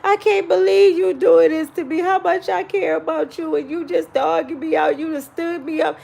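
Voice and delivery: mock weepy and hurt; fake crying. Speaker pretending to cry at the man who stood them up, mock tearful. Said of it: "I act like I'm started crying".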